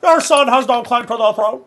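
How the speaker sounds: lightly voiced lord's solemn decree; Ned Stark impression. Streamer imagines himself as Ned Stark, mock stern. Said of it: "Imagine me saying Ned Stark lines".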